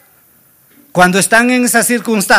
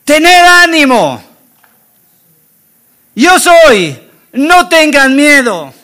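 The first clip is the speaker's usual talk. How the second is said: drawn-out, higher call over distance; 4 semitones higher, 3 dB louder, slower than usual. Acted. Jesus calls to frightened disciples out on the water, calling out. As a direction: call it out slowly to people far off. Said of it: "Aquí les tuvo que gritar".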